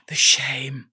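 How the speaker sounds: breathy mock-dramatic whisper. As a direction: hiss it like a dark secret.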